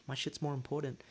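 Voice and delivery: high, soft impatient muttering; mock-impatient inner voice, high and small; eagerly waiting. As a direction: hurry someone along under your breath. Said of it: "I'm just eagerly waiting".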